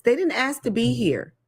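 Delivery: normal talking voice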